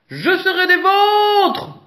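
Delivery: high, loud, slow shout from a tree; drawn-out high shout; monkey shouting. A narrator's reading. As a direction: call down from the banana tree. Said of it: "hurle Singe".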